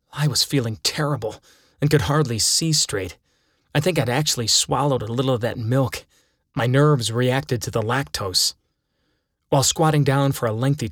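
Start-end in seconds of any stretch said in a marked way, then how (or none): none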